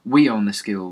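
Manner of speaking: put-on loud gleeful boast from a wild kid; kid's yell. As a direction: shout it like a kid running riot. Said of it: "one yelled".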